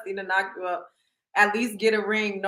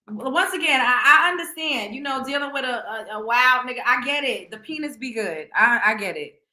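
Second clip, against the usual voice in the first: usual speaking voice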